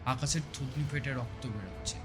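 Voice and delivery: plain reading voice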